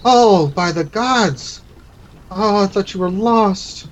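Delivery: high, slow, drawn-out cry of relief; tearful relief. Acted. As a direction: overcome uncle, stretching out the words. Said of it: "he's starting to tear up".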